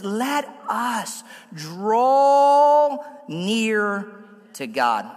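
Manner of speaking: very slow, draw held long, God dropped low; drawn-out reading. Shown as a demonstration. Pastor rereading Hebrews to the church, very slowly. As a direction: stretch every word out. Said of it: "the writer of Hebrews says".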